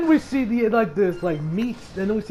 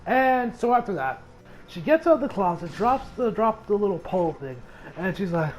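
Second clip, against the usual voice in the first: usual speaking voice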